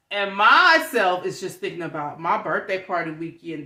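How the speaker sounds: ordinary delivery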